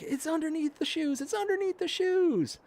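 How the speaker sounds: mock fright, very high; frightened high voice. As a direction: repeat it in a scared squeak. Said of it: "And she's like".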